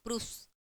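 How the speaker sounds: "प्रूफ्स" ending in a voiceless s; final s sound. Shown as a demonstration. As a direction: end "प्रूफ्स" on s, not z. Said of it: "स हो जाएगा". ("प्रूफ्स" के अंत में स की ध्वनि)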